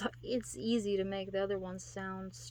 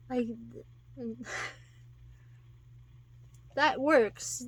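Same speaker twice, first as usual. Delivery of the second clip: ordinary talk, nothing marked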